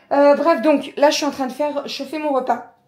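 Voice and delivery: talking between hiccups. Real, not acted. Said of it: "Pardon, j'ai le hoquet".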